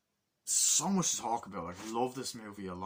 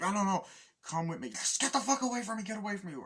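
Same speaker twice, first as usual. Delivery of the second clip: high, loud and shrill-pitched; frantic yell; 11 semitones higher, 4 dB louder, faster than usual. Retold or imitated. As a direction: push them away screaming. Bloodied woman in shock.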